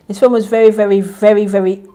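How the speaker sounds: loud, high, hammering each very; loud emphatic. Real, not acted. Vlogger to viewers about a film, loud emphatic burst. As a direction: burst out after the hush. Repeats loudly after whisper.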